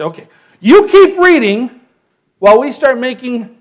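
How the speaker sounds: loud, high eager call; hammed-up crowd keen to start; excited crowd telling Ezra to keep reading; eager mock shout. People eager to build.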